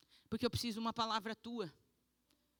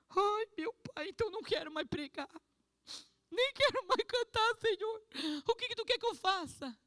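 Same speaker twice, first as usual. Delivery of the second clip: high pleading cry; 11 semitones higher, 5 dB louder than usual. Retold or imitated. Pleading surrender to God, retold.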